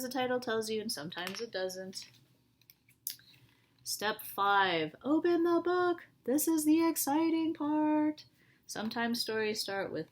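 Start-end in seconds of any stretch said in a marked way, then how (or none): 5.0-8.2 high, loud and thrilled; excited announcement. Shown as a demonstration. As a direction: sell the excitement of opening the book.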